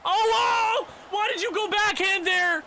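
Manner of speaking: high, loud disbelieving outcry; whoa, why would you do that; stunned shout. Real, not acted. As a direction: yell in shock at the screen. Reacting to a bad play.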